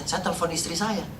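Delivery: ordinary talk, nothing marked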